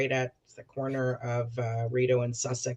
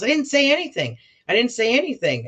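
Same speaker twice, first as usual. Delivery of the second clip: higher, whiny denial; mock innocent protest; 10 semitones higher, 4 dB louder, faster than usual. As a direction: play him as the wronged innocent. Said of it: "He goes".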